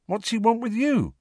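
high, nervous question; uneasy Ron. A narrator's reading. Ron asks Harry why Snape wants him, uneasy. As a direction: worry about your friend.

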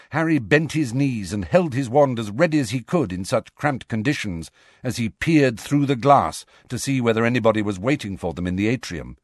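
ordinary delivery, nothing marked

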